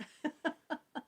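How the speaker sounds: soft, high chuckle; real, quiet giggle. Real, not acted. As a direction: chuckle lightly to yourself. Chuckles while chatting with viewers.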